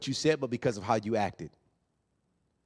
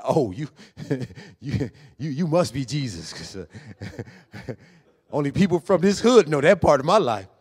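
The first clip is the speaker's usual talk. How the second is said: laughing woman; 6 dB louder than usual. Acted. Woman voiced laughing in story.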